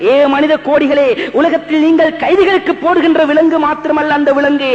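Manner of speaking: usual speaking voice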